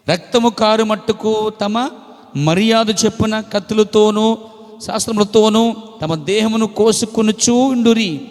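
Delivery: loud, slow pulpit reading of the verse; preached scripture reading. Acted. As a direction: proclaim the verse to the congregation, loud. Reading scripture at full force. (గట్టిగా, నెమ్మదిగా వాక్యం చదవడం)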